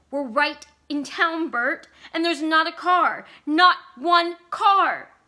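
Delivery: wife building from anxious to frantic shout; rising panic. Acted. Vicky escalating panic.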